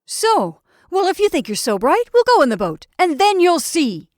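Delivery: loud, high-pitched angry retort; highly exasperated. A narrator's reading. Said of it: "highly exasperated".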